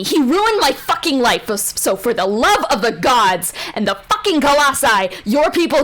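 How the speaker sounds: furious mother. Acted. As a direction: tear into her, building to a yell. Mother rages at the witch.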